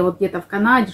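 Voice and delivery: ordinary talk, nothing marked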